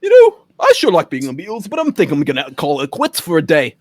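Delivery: a light put-on Liverpool Beatle voice; mock Liverpool accent. Joking friends hear a Liverpool Beatle accent impression. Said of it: "What is this accent?".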